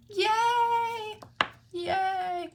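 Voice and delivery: high, happy little cheers; excited cheer. Real, not acted. Crafter cheers her own clean stamp, excited high yay.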